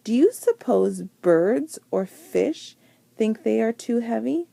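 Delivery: ordinary delivery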